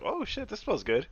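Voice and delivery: put-on high, soft, intrigued child; little kid voice. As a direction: coo it quietly like a kid finding a treat. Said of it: "little kid can just smell that and be like".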